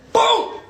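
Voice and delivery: high loud boom for the punch; punch sound. Shown as a demonstration. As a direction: shout the hit like a sound effect. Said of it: "Duck and come across with an overhand".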